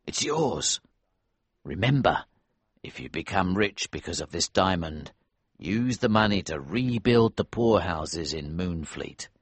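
gentle sincere. A narrator's reading. Elsevier gives diamond, advises.

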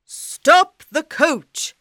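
loud, slow royal order; commanding king. Acted. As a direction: bark the order to the driver.

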